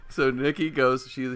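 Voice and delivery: ordinary talk, nothing marked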